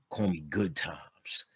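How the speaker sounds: slow whisper, unveiling the name; whispered reveal. Retold or imitated. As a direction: breathe it out slowly like a secret. Friend reveals new name.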